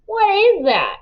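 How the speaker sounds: high sneering question; mocking disbelief; disgusted. A narrator's reading. Dylan sneering at food.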